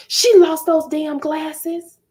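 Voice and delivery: high, loud, slow complaint; irritated mom. Retold or imitated. Mother complaining to the father about the child, high and loud. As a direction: spit it out like an annoyed mother.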